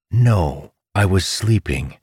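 dry mock denial; deadpan sarcasm to a friend; sarcastic. A narrator's reading. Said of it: "Amandal said sarcastically".

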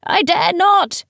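high, slow, terrified refusal; frightened call back. A narrator's reading. Teacher refuses Palamedes from the ladder, frightened call. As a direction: call back in fear, drawing it out. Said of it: "he called back".

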